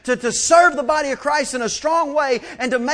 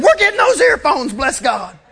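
exasperated outburst; 5 dB louder than usual. Retold or imitated. A father fed up with endless questions, exasperated outburst. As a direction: blurt it out, at the end of your rope.

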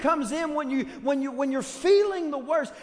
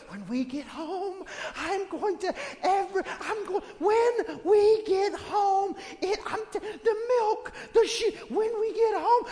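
a hushed, strained threat through a forced smile, breaking into laughter; mock parent threat; 7 semitones higher than usual. Parent in the car threatening the kids before church, hushed and laughing. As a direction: mutter a warning into the back seat, then crack up.

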